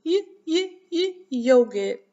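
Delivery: high, punchy sounding-out of "yogurt"; first sound repeated. Shown as a demonstration. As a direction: drill the "yuh" sound for a class, then "yogurt". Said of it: "I didn't hear you".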